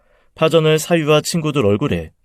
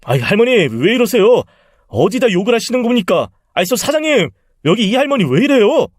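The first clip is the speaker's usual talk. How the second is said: higher, panicked yelp of a man just hit; flustered cry, calling the owner for help; startled protest; 10 semitones higher, 4 dB louder than usual. Acted. Man hit by an old woman, panicked protest to the owner.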